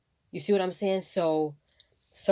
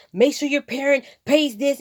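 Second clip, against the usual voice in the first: higher, louder cafeteria lady order; mock stern manager; 8 semitones higher, 9 dB louder than usual. Kitchen manager ordering a little second grader, loud mock sternness. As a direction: bark the rule at a small kid.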